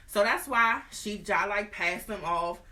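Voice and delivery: ordinary talk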